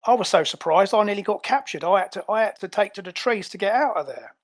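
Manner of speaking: indignant Cockney henchman; Cockney, incredulous and accusing; Cockney ape. Acted. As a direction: argue back in a broad Cockney accent. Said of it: "I love that he's a Cockney ape".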